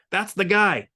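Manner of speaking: high, loud accusing call; bellowing. A narrator's reading. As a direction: shout him out to the crowd. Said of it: "he bellowed".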